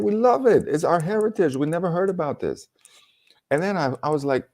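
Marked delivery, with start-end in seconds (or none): 0.0-2.7 put-on high, eager children's voice; high childlike delight; kids' eager voice. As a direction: pitch it up like excited kids.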